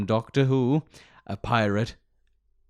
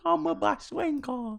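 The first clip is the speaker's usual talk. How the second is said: hammed-up British accent, much higher; mock British accent; slower than usual. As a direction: play a ridiculous Brit, high and drawn out. Continuing British caricature.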